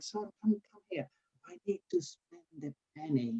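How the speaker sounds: a hint of a confused old patient calling.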